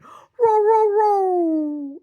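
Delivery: little dog barking. Retold or imitated. Little dog barking at driver, yappy mock barks. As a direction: imitate a small dog's bark. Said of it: "he went".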